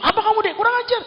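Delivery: husband exploding at his wife; mock furious husband. Husband yells after his wife yanks his towel, mock furious.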